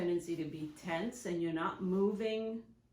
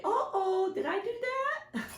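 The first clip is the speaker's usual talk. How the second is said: much higher, goofy nerdy TV voice; TV sitcom catchphrase; 13 semitones higher than usual. Retold or imitated. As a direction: play it like the clumsy sitcom kid. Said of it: "old T V show".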